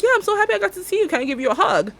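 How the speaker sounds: high, fast and eager; gushing stranger. Retold or imitated. Client's mom asking for a hug, high and gushing. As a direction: gush about seeing her again. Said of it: "she's like".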